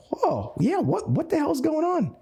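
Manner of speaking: high-pitched mock astonishment; startled prospect. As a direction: voice jumps up high, taken aback. Imitates startled prospect's reaction.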